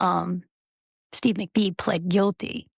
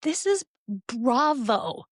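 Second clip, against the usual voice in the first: incredulous viewer; 5 semitones higher, slower than usual. Retold or imitated. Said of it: "you're just like".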